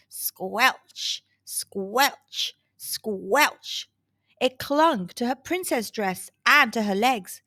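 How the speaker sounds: ordinary delivery, nothing marked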